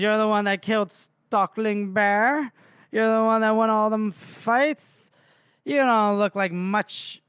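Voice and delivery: high-pitched mock drunk taunt; hammed-up drunk picking a fight; hammered challenger. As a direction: goad him into a fight, playing drunk. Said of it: "just straight up hammered".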